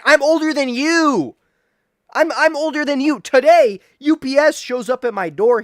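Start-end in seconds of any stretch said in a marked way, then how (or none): 0.0-3.2 loud, high, indignant yell at the clerk; indignant shout. Retold or imitated. To the store clerk, loud high indignant protest. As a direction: shout it like a grown adult insulted. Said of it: "I was like".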